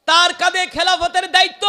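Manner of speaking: high, very loud proclamation; emphatic preaching shout. Acted. Preacher driving home Omar's new duty, high loud shout. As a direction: belt it out on a high sustained pitch.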